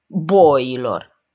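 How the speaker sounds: loud, contemptuous outburst; scornful shout. A narrator's reading. Iacobescu insults the staring group, scornful shout. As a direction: throw it out loudly with disdain. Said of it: "izbucni într-un râs disprețuitor".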